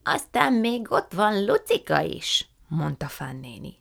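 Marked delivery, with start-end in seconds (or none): 0.0-2.5 put-on high, slow, drawn-out old lady voice; effusive old aunt. A narrator's reading. Aunt Fan welcoming the guests, slow and high. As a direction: stretch every word with delight.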